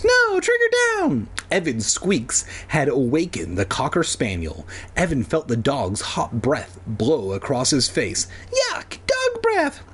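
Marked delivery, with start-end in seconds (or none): 0.0-1.3 very high squeaky pleading; mouse voice. Acted. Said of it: "in his little mouse voice". 8.7-9.8 very high slow squeak. Acted. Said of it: "he squeaked".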